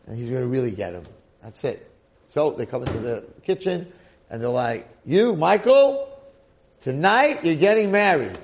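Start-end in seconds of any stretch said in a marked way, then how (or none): 5.1-8.4 loud, high proclamation at the slave; booming order, drawn out on the name; commanding announcement. Acted. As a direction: call it out forcefully, pitch jumping up. Masters announce wedding to slave.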